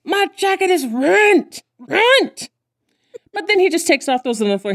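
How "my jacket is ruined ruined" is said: high, drawn-out wail over a ruined jacket; whiny tantrum. Retold or imitated. Spoiled star whining about his soda-stained jacket, high and slow. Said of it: "He's like".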